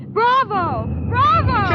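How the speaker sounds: loud, high cheer rising on the second; excited cheering. Acted. Kismine cheering the guns at the window, delighted. Cheering anti-aircraft fire.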